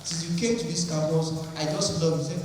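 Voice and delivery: ordinary talk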